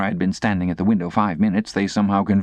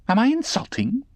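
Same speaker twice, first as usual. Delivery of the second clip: put-on taunting girl. Estella taunting.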